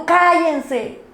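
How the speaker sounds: high-pitched imitation of an annoyed crowd; mock crowd scolding. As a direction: play the crowd shushing, lightly. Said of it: "Y la multitud".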